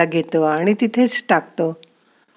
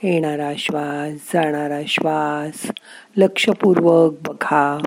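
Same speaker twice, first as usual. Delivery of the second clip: slow, soft calming meditation voice; guided meditation; 3 semitones lower, 3 dB quieter than usual. Acted. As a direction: ease the listener into stillness, slowly. (शांत, हळू ध्यान-मार्गदर्शनाचा आवाज)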